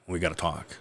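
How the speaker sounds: low, hushed, ominous summons; soft and low, after a heavy pause; grave grandma. Retold or imitated. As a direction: quietly announce a serious talk.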